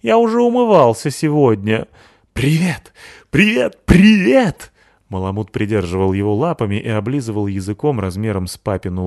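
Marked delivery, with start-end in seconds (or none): 0.0-1.9 put-on raised, loud protesting child; indignant shout. Возмущённый мальчик в рассказе. 2.3-4.7 high, loud, rising puppy joy; excited dog greeting. Acted. Радостный пёс Рокки здоровается.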